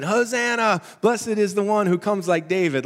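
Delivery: high, excited street acclamation; acclaiming crowd. Acted. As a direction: call it out like a cheering crowd. Acting crowd shouting in the street.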